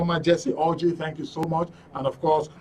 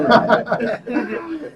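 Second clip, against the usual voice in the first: a quick, high burst of laughter; 9 semitones higher, 5 dB louder than usual. Real, not acted. Host laughing at the guest's reply, quick high laugh. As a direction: laugh it off brightly.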